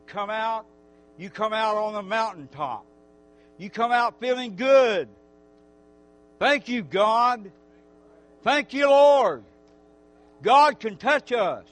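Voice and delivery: loud, fervent preacher voice; preaching. Acted. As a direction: preach it out to the whole church. Praise rising again.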